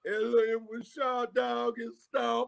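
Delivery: high, wailing mock sob for mama; mock crying. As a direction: blubber like a grown man crying for his mother. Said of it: "he's crying all over the shit".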